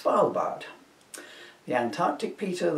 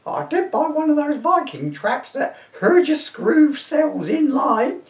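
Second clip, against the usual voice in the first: put-on high shrieking old woman; an indignant old dear shooing a Viking; old lady to constable, high and indignant; crone voice; 12 semitones higher, 5 dB louder, slower than usual. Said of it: "my crone voice".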